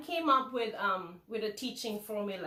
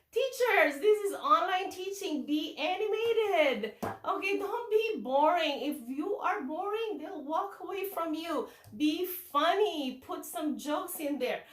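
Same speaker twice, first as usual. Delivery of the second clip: be animated, stretching the key words; 5 semitones higher than usual. Shown as a demonstration. Demonstrates animated teaching to teachers.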